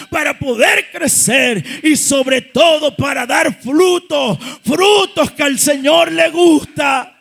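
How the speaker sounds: loud, stretched preaching phrases; full-force preaching. Real, not acted. Preacher urging the church to bear fruit, full-force preaching.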